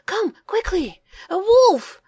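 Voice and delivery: high, loud urgent call; frantic alarm shout. A narrator's reading. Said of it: "he shouted".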